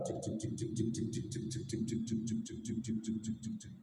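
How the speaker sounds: rapid unvoiced chopper rotor noise; helicopter noise. Shown as a demonstration. Storyteller makes the helicopter sound for the children, whispered chopping. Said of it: "Suara helikopter tahu nggak?".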